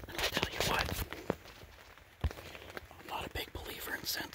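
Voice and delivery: hushed, unvoiced-sounding whisper from the blind; excited news kept to a whisper; hunter's whisper. Real, not acted. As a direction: whisper low so the animals don't hear.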